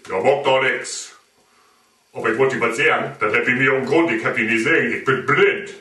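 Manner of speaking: low, fast rabbit grumble; deep-voiced rabbit explaining. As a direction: drop down as the blind rabbit.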